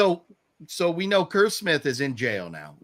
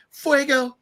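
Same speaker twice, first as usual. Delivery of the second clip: high, loud mimicked cries; hammed-up distress in a raised voice; mock traumatized girl; 9 semitones higher, 7 dB louder, slower than usual. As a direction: imitate an overacted frightened girl. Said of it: "she's so traumatized".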